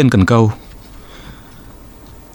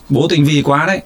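out of breath; 5 semitones higher than usual. A narrator's reading. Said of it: "nói trong hơi thở phì phò".

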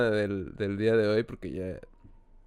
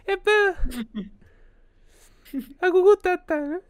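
put-on very high, loud squeaky baby; 5 dB louder than usual. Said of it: "Se le agudó la voz". (voz de bebé chillona y aguda)

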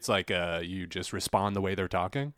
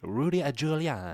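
mock Italian accent; 5 semitones higher than usual. Mock Italian riff on name.

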